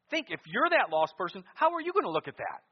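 loud, high challenge to the congregation; urgent pulpit shout; full-force preaching. Real, not acted. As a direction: push the question up into a cry.

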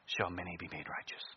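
ordinary talk, nothing marked

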